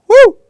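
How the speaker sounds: a sharp high whoop as a demonstration; loud high woo. Shown as a demonstration. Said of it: "if, if I go".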